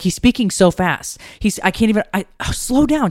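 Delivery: high-pitched, overwhelmed retelling; overwhelmed plea. Retold or imitated. Medium to a fast-talking spirit, overwhelmed. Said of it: "She's crying 'cause he's".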